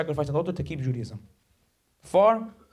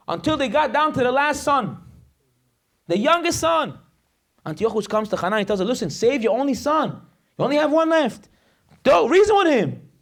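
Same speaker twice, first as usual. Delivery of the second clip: high-pitched urgent storytelling; fiery preaching; 7 semitones higher, 4 dB louder than usual. Real, not acted. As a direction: build to a loud, high climax. Story preached at full force.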